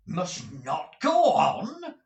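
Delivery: near shriek. Acted. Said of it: "almost in a shriek".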